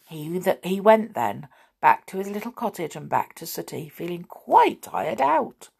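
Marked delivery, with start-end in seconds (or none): none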